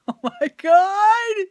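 loud, high, drawn-out groan of disbelief; exasperated outburst. Real, not acted. Fed up with the beeping driver, loud slow exasperation. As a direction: stretch it out, fed up.

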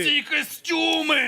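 high, drawn-out shout of delight; excited shout. Real, not acted. Wowed by all the costumes on show, excited shout.

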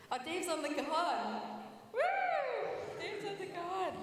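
high, loud excited call; excited shout. Real, not acted. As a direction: cheer it out, thrilled.

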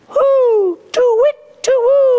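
high, loud hoots; owl hooting. Acted. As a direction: hoot like an owl, long last note. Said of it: "An owl says".

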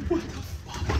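hushed, voiceless disbelief; stunned whisper. Real, not acted. Viewer stunned by the episode, whispering in shock. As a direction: whisper it, barely audible. Stunned after long silence.

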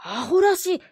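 high, loud outburst of disbelief; indignant child shout. Acted. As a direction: blurt it out like a scornful boy. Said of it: "と吾輩は思わず叫んだ".

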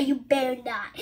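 low, soft, muttered threat; quiet warning. Retold or imitated. Son warning his parent, softly and low. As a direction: say it under the breath as a warning. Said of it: "he will say".

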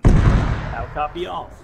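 slow, loud radio order; loud command. Real, not acted. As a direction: bark it out to the whole net. Commander demands confirmation on radio.

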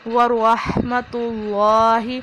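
ورحمۃ اللہ slow, heavy ra, full lam; correct recitation. Shown as a demonstration. Teacher demonstrating ورحمۃ اللہ. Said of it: "روا کو پر پڑھیں گے".

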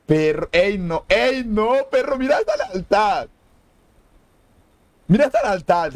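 rising, high-pitched awe; moved amazement. Real, not acted. Moved by the players' loyalty.

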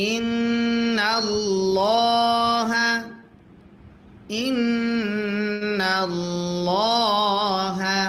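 ল্লা in ইন্নাল্লাহা said thick and full, very slowly; heavy lam. Shown as a demonstration. Teacher shows the thick ল্লা in ইন্নাল্লাহা. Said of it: "তখন মোটা করে পড়তে হয়".

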